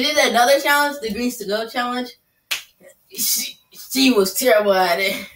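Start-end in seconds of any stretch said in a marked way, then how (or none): none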